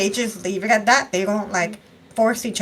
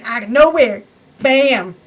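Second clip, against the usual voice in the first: loud, raised, punchy reveal; dramatic surprise; 4 semitones higher, 8 dB louder than usual. Retold or imitated. He suddenly shows up in the end, loud dramatic surprise. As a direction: make the sudden return pop.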